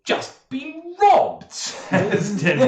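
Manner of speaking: high, loud indignant outburst; outraged Tyndall. Acted. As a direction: squawk it out in disbelief. Tyndall outraged at being robbed.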